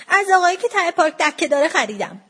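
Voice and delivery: put-on child's hurried, high-pitched answer; quick blurt. A narrator's reading. Frightened child giving up the seller, quick blurt. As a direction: blurt it out at once. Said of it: "بچه تندی گفت".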